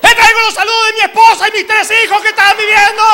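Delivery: high, very loud laughing shout; shouted greeting. Retold or imitated. Preacher shouting greetings to please the crowd, very loud. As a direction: yell a family greeting at full volume. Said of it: "empecé a gritar".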